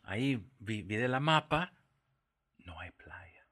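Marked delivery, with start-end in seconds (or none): 2.6-3.5 whisper. Real, not acted. Punchline of disappointing map discovery.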